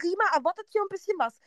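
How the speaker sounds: high, quick retold reprimand; telling-off. Friend warning the groom what Rima expects, telling-off. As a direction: lay down expectations, fast and high. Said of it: "noch eine Ansage gemacht".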